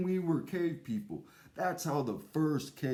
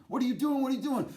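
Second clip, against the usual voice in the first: high alarmed cries of a startled caveman; 10 semitones higher, 4 dB louder, much faster than usual. Acted. Cave brother shouting at his friend, alarmed and high.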